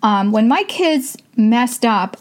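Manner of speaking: ordinary talk, nothing marked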